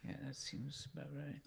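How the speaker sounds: nervous unvoiced whisper; whisper, scared to jinx the roll. Real, not acted. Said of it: "I love that you're whispering".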